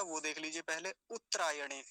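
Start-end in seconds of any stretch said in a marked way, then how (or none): 1.1-1.8 उत्तरायणे squeezed to "uttrayane"; quick उत्तरायणे with a missing vowel; wrong pronunciation. Shown as a demonstration. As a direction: swallow the a after tt in उत्तरायणे. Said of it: "एक गलत उच्चारण क्या हो सकता है".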